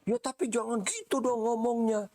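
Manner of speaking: high, whiny quarrel voice; mock whiny complaint. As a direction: pitch way up like a sulky partner mid-argument. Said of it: "orang yang lagi berantem".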